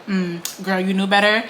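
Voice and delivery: normal talking voice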